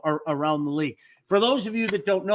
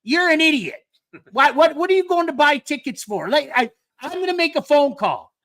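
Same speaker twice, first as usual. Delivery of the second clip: high, loud exasperated outburst; mock exasperation, pitched up and loud; irritated rant; 8 semitones higher, 4 dB louder than usual. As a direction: act out a worked-up scolding. Quoting an irritated Phil's rant.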